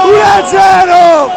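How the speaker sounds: shouted goal call, high and loud; breathless italian goal commentary. Real, not acted. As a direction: scream the score out.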